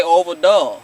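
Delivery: imitated Southern country accent; country accent imitation. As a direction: mimic Keisha's country twang. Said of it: "I couldn't pick up Keisha's accent".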